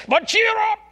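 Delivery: high, loud shouted cheer up; ringing shout. Acted. Jesus cheering his disciples, a high ringing shout. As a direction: lift them with a shout.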